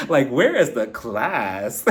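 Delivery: slow, drawn-out amused disbelief; playful scolding through a laugh; laughing mock outrage. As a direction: stretch the question out, about to crack up.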